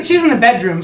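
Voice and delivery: ordinary talk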